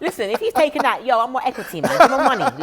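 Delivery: high, loud, laughing protest; laughing mock outrage. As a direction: play up fake outrage, laughing. Mock outrage over stolen quote.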